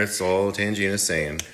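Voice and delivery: ordinary talk, nothing marked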